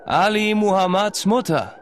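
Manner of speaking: calling loudly into a gorge. A narrator's reading. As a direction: shout down into the chasm. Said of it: "beugte er sich hinab und rief".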